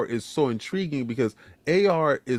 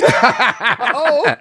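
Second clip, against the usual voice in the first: louder, higher with a laugh; 9 semitones higher, 9 dB louder than usual. Acted. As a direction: laugh through the taunt. Teasing rivals, laughing.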